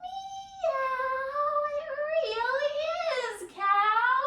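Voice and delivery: high, singsong kitty voice; cat voice. Pretend. Teacher plays Cat answering Cow, high meowing voice. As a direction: meow high and carry it through the words. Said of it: "And Cat responds".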